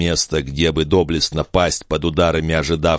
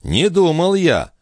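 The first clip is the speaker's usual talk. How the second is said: high, drawn-out sarcastic remark; biting, stretched-out irony; cold and caustic; 11 semitones higher, slower than usual. A narrator's reading. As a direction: needle her with mock surprise. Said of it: "холодно и язвительно заметил Жильбер".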